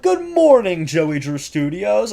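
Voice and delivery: high, slow announcer greeting; intercom announcer voice. Retold or imitated. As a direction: boom it out like a cheery PA announcer. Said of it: "he just comes on the, like, intercom and it's like".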